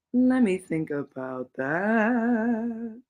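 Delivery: very slow musing; slow drawn-out thinking. Real, not acted. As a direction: drag it out while thinking. Thinking slowly to herself.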